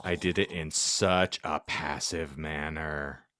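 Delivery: usual speaking voice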